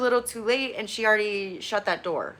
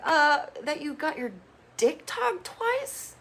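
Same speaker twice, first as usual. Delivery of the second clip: much higher, very slow disbelieving sneer; incredulous mockery; 7 semitones higher, slower than usual. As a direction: draw it out, are you serious. Mock answer to Kenan.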